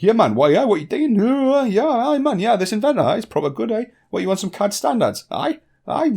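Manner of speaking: high, loud, hammed-up Northeast English accent; broad Northeast English accent. Shown as a demonstration. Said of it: "very broad with this accent".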